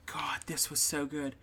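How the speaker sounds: soft whispered aside. Real, not acted. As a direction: lean in and whisper it. Aside to cohost.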